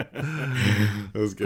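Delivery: talking through laughter; laughing. Real, not acted. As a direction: laugh while saying it. Laughing at impression.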